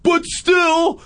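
put-on high, loud teen whine; whiny teen son. Son dreading school drop-off, high whining shout. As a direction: whine like a mortified teenager. Son's protest.

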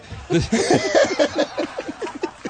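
bursting into high laughter. Real, not acted. Laughs at the teasing.

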